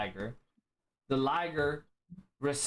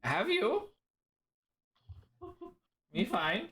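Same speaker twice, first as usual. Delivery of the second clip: much higher, puzzled inverted phrasing; confused creature voice; Liger's backwards talk; 10 semitones higher, slower than usual. Acted. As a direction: Liger echoing words in reverse order. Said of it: "He speaks like Yoda".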